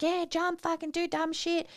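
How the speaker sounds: high, soft mock crowd voice; mimicked egging-on. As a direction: imitate a goading crowd, pitched right up. Acts out crowd egging him on.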